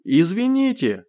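high, drawn-out cry; shouted apology. A narrator's reading. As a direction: call out sorry across the distance. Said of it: "крикнул он". (выкрик извинения)

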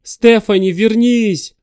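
desperate high shout after her. Acted. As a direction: yell her name into the dark. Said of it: "закричал он".

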